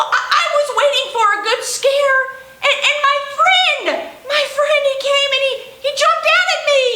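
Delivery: high, laughing, delighted penguin; bubbling over with relief and joy; excited. Acted. Penguin thrilled hiccups gone.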